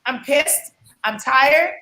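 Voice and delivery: normal talking voice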